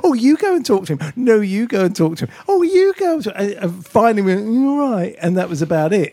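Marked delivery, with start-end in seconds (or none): 0.0-3.2 high-pitched mock fluster, passing the buck; imitating giggling people. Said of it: "ridiculous giggling people going". 4.5-5.1 high-pitched mock giddy fan; imitating giggling people. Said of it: "ridiculous giggling people going".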